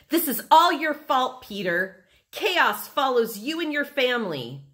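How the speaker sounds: high, loud accusation; angry blame. A narrator's reading. Libby blames Peter for the chaos, angry. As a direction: lay the blame on a troublemaker.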